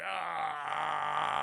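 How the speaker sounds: long, sustained mock yell; imitated villain roar; drawn-out roar. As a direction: hold one long monster-like cry. Imitating Electro reforming.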